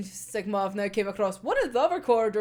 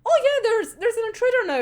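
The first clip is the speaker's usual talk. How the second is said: casual; 12 semitones higher, 5 dB louder than usual. Retold or imitated. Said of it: "Dazai just being casual about it".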